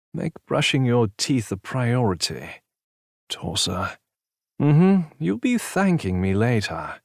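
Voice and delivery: lightly voiced cheeky friend; teasing friend. A narrator's reading. Brad teases.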